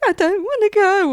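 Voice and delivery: whining like a tearful woman; high, whimpering reluctance; whiny high voice. Retold or imitated. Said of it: "she's like".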